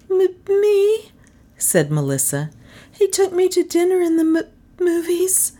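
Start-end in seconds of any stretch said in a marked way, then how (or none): 0.1-1.1 very high, stuttering woman's voice; high, stunned stutter; stammering shock. A narrator's reading. Shocked woman stammers her answer. 2.9-5.5 high, faltering woman's voice; very high-pitched, stuttering confession; stammering dismay. A narrator's reading. Shocked woman explains, stammering.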